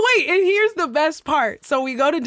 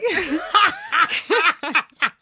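burst of laughter; 5 semitones higher than usual. Real, not acted. Telling her day party story, bursting into laughter. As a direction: crack up laughing.

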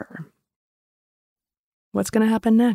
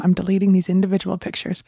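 ordinary delivery

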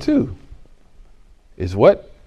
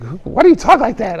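puzzled mom; 11 semitones higher, 5 dB louder than usual. Retold or imitated. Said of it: "She's like".

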